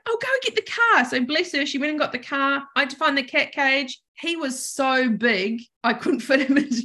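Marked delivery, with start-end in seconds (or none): 0.1-1.0 urgent landlord. Retold or imitated.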